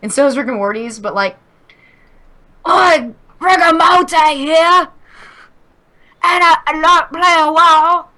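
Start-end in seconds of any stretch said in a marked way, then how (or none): 2.7-8.1 much higher, louder, slow cartoonish British voice; exaggerated British accent. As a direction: go full cartoon Brit, laughing through it. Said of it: "I need to be more rigor morty".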